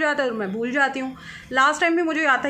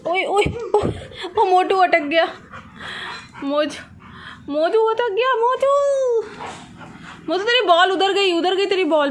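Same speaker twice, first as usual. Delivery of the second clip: high excited cheering at the dog; 7 semitones higher than usual. Real, not acted. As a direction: egg the dog on, high and thrilled. (कुत्ते के लिए ऊँची उत्साहित आवाज़)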